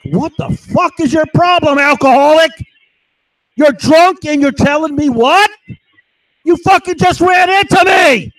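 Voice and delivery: high, loud furious shouting; retold furious yell. Yelling at the neighbor who hit him, furious high shout. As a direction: scream it at the drunk who knocked you down. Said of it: "And I'm yelling this at him".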